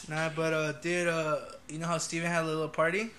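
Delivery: usual speaking voice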